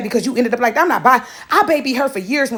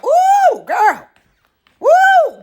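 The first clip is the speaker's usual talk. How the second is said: high whooping exclaim; 13 semitones higher, 5 dB louder, much slower than usual. Acted. Exclaiming loudly.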